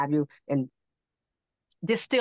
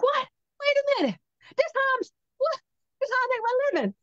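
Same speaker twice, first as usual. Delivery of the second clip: shocked protest; faster than usual. Retold or imitated.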